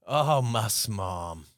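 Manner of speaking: normal talking voice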